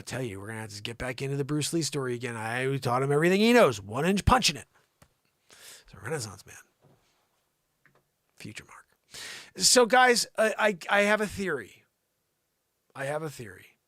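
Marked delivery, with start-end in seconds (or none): 5.5-8.8 soft whispered aside. Real, not acted. Host whispers a joke about himself. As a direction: whisper it to yourself.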